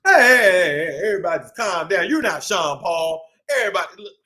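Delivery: ordinary delivery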